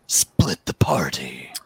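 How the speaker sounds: sly whispered aside; whispering. Real, not acted. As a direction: whisper it like a secret. Joking aside about splitting up.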